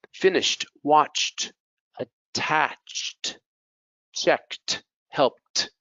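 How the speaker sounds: -ed said as a crisp T; final T sound. Shown as a demonstration. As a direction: end each word on a released T, word by word. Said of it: "these ones have a T sound".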